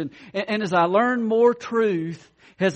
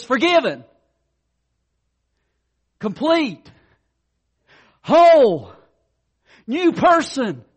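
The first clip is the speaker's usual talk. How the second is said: emphatic proclamation; 4 semitones higher, 8 dB louder, slower than usual. Acted. God declaring the believer forgiven.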